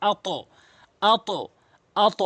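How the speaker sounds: আত echoed as a rounded "ato"; upward qalqalah. Shown as a demonstration. Teacher modelling to for learners, upward bounce on আত. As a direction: release the t of আত into a heavy "o" bounce. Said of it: "এভাবে উচ্চারণ করব".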